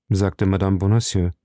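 usual speaking voice

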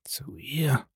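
hushed, whispered murmur. A narrator's reading. Stunned father repeats the servant's words, murmuring in a whisper. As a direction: whisper it, barely voiced. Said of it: "murmelte er".

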